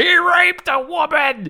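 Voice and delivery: high, drawn-out mock outrage yell; mock hysterical shout. Host mimicking angry reviewers of a game, hammed-up hysterical shouting. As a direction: bellow it like a hammed-up angry mob.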